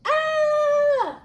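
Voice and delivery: high, loud "ah" scream; sudden high-pitched cry, acted out; trapped scream. Shown as a demonstration. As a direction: demonstrate a pent-up scream of feeling trapped. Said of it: "This is how you feel".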